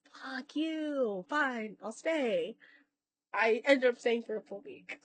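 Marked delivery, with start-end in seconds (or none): 0.1-2.6 retold sulky giving-in, soft and drawn out; acted-out reluctant, softer drawl; grudging surrender. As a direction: give in slowly and resentfully, under your voice.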